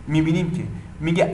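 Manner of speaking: usual speaking voice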